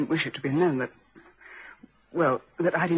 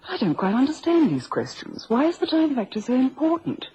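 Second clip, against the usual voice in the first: higher-pitched, affronted protest; politely indignant, pitched up; indignant questioning; 8 semitones higher, 3 dB louder than usual. Acted. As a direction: push back at the questioning, pitch rising. Suspect objects to police questioning.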